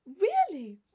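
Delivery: high, loud mock surprise; affected astonishment. A narrator's reading. Tilney feigning astonishment at Catherine's answer. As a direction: gasp it out in exaggerated amazement. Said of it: "with affected astonishment".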